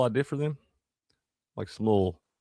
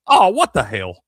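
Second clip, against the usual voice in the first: loud, higher "oh" of surprise; a toddler staring at the broken window; three-year-old surprised; 9 semitones higher, 11 dB louder than usual. Retold or imitated. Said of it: "Cal just looks up and goes".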